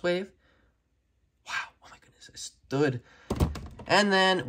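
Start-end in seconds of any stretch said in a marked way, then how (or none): 1.5-3.0 hushed, breathy whisper of relief. Real, not acted. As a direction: whisper it in amazed disbelief. Figure finally stands, hushed awe.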